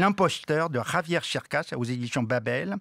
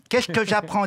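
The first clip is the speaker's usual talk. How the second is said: raised, indignant outburst; mock angry boss; 6 semitones higher, 4 dB louder than usual. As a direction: play the outraged host. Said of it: "dit Nagui".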